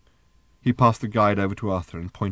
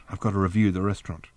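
ordinary delivery

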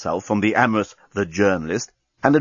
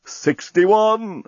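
slow robotic countdown voice; talking bomb; 7 semitones higher, much slower than usual. Acted. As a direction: count down slowly like a machine. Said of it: "said the bomb".

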